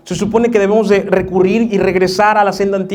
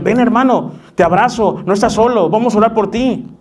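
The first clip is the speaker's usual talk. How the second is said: warm welcome; 6 semitones higher, 3 dB louder than usual. Retold or imitated. Church welcoming confessing brother.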